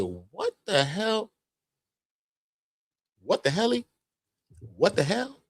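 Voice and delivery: high, slow mock "what the hell"; mock disbelief. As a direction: act stunned and baffled. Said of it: "I said".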